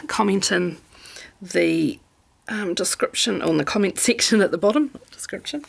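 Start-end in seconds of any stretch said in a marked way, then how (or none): 4.9-5.6 whispered correction. Real, not acted. Quick self-correction aside.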